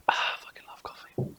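breathy whisper of pleasure; whispered aside. Real, not acted. Host whispering to himself about loving coffee. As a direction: whisper it to yourself.

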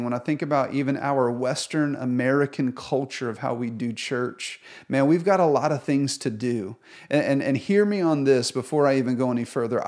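ordinary delivery

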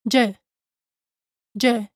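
J buzzed like the s in measure, zh; Spanish ll sound. Shown as a demonstration. Teacher isolating the ll at the start of llover. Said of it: "The initial sound, j".

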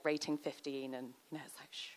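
fading almost to nothing, ending on a shh; very quiet, as the film starts; hushed. Acted. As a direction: drop to a cinema hush. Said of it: "it just goes quiet".

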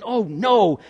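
dramatised shock; gasping dismay. Acted. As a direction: blurt it out, acting appalled. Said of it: "Peter gasping".

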